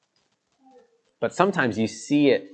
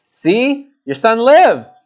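much higher, louder cry of joy; triumphant announcement; 9 semitones higher, 6 dB louder, much slower than usual. Acted. Prophet handing the son back to the mother, excited. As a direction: show her proudly. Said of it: "And Elijah said".

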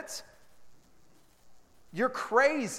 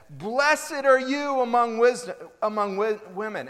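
loud proclamation; 4 semitones higher, 5 dB louder than usual. Acted. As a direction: proclaim it like a sudden shout of joy. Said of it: "she spoke out with a loud voice".

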